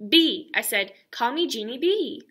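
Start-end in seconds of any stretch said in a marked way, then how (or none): 0.1-0.5 B yelled out loud; child correcting her teacher, loud; loud holler. Acted. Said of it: "I hollered the B part real loud". 1.1-2.2 B hollered loud at the end; child insisting on her name, stressing B. Acted. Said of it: "I hollered the B part real loud".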